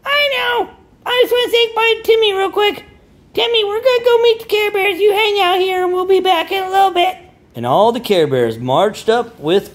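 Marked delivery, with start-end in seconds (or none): 0.1-7.2 very high, fast cartoon boy voice; quick cartoon Cosmo. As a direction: rush the goodbye like a cartoon fairy. Cosmo saying bye to Timmy.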